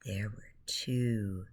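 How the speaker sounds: usual speaking voice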